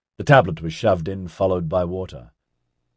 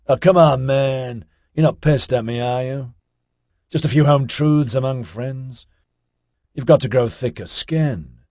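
higher-pitched, laid-back dude protesting; cajoling dude; 6 semitones higher than usual. A narrator's reading. Ozzy to his silent listener, cajoling. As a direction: coax a touchy friend, voice raised in pitch.

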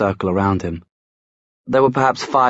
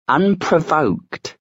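high, drawn-out scornful echo; slow mocking sneer; 8 semitones higher, much slower than usual. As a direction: stretch the word in scorn. Werewolf mocking Will's word.

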